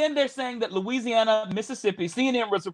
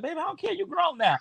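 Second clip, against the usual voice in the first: imitating mama; 8 semitones higher than usual. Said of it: "She's like".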